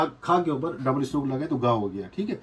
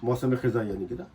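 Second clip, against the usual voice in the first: ordinary talk, nothing marked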